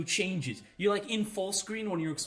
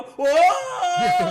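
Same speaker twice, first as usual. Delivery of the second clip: long, loud, high sung whoa; held musical whoa; 13 semitones higher, 13 dB louder than usual. Retold or imitated. Gamer imitating his friend's held-note whoa. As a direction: hold it out like a note. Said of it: "held out a musical note".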